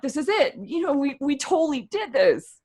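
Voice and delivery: high, giddy victory at the summit; triumphant. Retold or imitated. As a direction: celebrate like you've made it. Retold triumphant thought.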